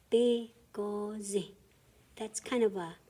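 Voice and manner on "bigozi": "Bigozi" drawn out slowly; slow teaching model. Shown as a demonstration. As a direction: stretch "Bigozi" out. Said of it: "a long word that you kinda say fast".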